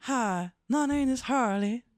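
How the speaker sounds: put-on Southern belle accent. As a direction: introduce yourself as a Southern belle puppet. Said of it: "Oh, she's Southern".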